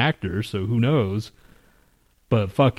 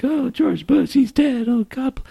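high, squeaky fake grief; mock mourning; 14 semitones higher, slower than usual. Mocking people who suddenly mourn George Bush, in a high fake wail. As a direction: mimic people wailing over a dead president. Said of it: "all these people are like".

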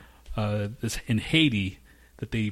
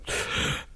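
sudden gasp of someone waking up; acted-out waking gasp; imitated gasp. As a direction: suck in air like waking in the dark. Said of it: "all you hear is, like, this guy go".

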